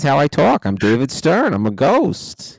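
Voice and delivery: loud, emphatic ghostly introduction; too close on mic. Acted. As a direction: belt out the introduction, rising at the ends. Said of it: "tell you to back off the mic".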